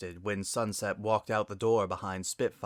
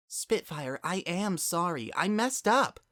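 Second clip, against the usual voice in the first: pleading apology; 9 semitones higher than usual. Acted.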